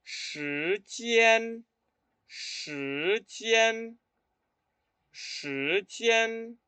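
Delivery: "Shi jian" repeated three times, tone on "jian"; 示范时间的声调; Mandarin tones. Shown as a demonstration. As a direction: model "Shi jian" slowly and clearly. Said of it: "¿Colocaron este tono".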